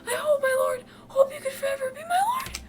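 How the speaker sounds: very high squeaky-pitched devoted retainer; put-on falsetto servant. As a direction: voice an earnest, high-pitched attendant. Reads Phil's lines in voice.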